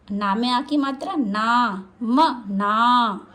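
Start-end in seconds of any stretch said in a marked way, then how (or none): 2.0-3.2 म ना said very slowly, split apart; syllable by syllable. Shown as a demonstration. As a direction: sound out each syllable. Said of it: "इन तीनों के उच्चारण पर ध्यान देंगे।".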